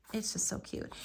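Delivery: soft whispered repeat; a hushed little aside. Real, not acted. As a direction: breathe it to yourself, unvoiced. Repeats compliment softly.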